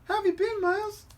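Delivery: high, light put-on girl voice; squeaky teen girl greeting her friend. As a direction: pitch way up and play the girl. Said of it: "I need to get my girl voice going".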